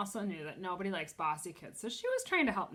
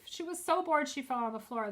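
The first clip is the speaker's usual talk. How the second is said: ordinary delivery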